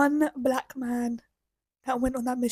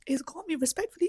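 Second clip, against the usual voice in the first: ordinary talk, nothing marked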